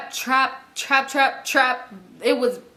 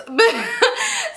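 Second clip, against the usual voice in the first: sudden high real laugh; quick high giggle; 11 semitones higher than usual. Real, not acted. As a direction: burst out laughing. Laughing at the coming fight.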